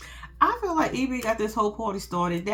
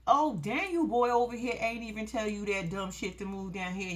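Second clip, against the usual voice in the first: ordinary talk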